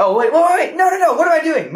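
loud, high, frantic self-correction; sudden excited realization. Real, not acted. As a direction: stop yourself in a high, loud rush. Sudden realization of the right actor.

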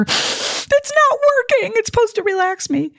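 high, slow, whining voice; mock a whine over the useless oil; whiny complaint. Complaining to husband Dan the lavender won't work, whiny.

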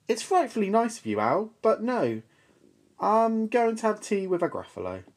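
high, fast polite refusal; bright little mouse. Acted. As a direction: chirp it off quickly. Mouse answers owl.

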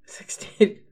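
unvoiced whisper; whispered guess. Real, not acted.

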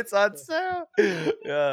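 high, eager hammed-up sale call; mock sale pitch. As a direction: sell it like a giddy clerk. Mimicking sale announcement.

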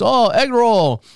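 hammed-up chef announcing a trick; mock hibachi chef. Chef to diners, slow mock showman call.

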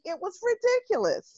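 "ridiculous" squeaked up high and soft, a disbelieving laugh at herself. Real, not acted. Guest scoffing at her past fear of speaking, high and soft. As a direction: pitch "It was ridiculous" way up and pull the volume back on "ridiculous".

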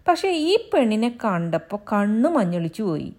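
lightly voiced angry husband, a touch of bluster. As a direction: bluster that he will beat her. Husband explains himself.

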